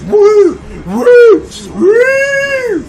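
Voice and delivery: very high whooping alarm; alarm siren. Acted. Bullshit meter going off, high siren whoops. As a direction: whoop like a detector going crazy. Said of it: "my bullshit meter goes off".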